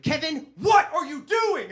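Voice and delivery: high, loud outraged demand; angry parent catching son, high and loud; furious parent. Retold or imitated. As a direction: confront the kid, outraged. Furious parents confront him.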